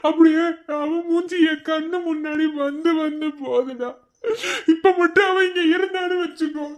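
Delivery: high, slow, crying voice; tearful longing. Acted. New father aches for his missing wife, tearful wail. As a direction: break down in joy and longing, drawing words out.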